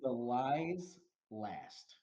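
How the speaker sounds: ordinary talk, nothing marked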